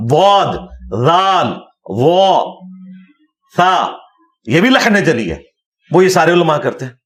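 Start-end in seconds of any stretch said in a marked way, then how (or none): 0.1-3.9 واد، ذال، وا، ثا each drawn out slowly, one by one; stretched, separate letters واد، ذال، وا، ثا with full articulation; tajweed letter drill. Shown as a demonstration. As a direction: articulate واد، ذال، وا، ثا as a Quran reciter would. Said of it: "قاریوں کے نزدیک".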